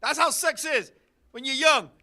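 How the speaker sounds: ordinary talk, nothing marked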